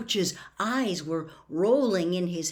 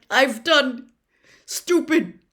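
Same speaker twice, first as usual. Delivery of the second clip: strained, higher, halting words; breathed; 6 semitones higher, 10 dB louder, slower than usual. A narrator's reading. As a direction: confess with painful effort. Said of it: "Mister Crouch breathed".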